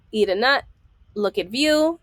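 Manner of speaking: ordinary delivery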